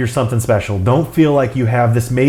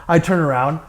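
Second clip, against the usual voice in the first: usual speaking voice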